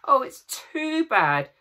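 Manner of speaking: Lucy sobbing over the missing body. A narrator's reading. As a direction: let the sob break the words. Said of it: "sobbed Lucy".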